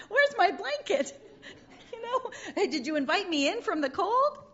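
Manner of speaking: can barely get it out for laughing; giggling through a punchline; laughing while quoting. Acted. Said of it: "Snoopy's rescuers quoted, laughing".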